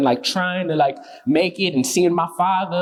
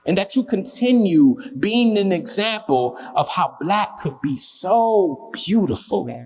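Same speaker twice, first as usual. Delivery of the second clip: spoken-word poem; 5 semitones higher than usual. Acted. Poet honours the Glenville neighbourhood, heartfelt spoken-word recital. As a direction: recite it line by line, pausing to let phrases land.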